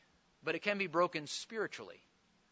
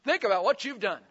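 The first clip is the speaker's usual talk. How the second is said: louder, higher stern mom or dad; scolding parent; 5 semitones higher, 6 dB louder than usual. Acted. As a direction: send a child off to think it over. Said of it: "Did mom or dad ever say to you".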